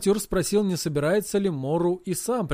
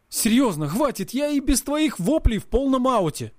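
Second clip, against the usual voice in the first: pleading stop; 7 semitones higher than usual. Acted. Hero begging his yelling friend to stop. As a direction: beg him to stop yelling.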